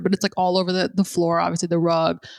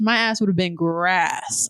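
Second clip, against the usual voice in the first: ordinary delivery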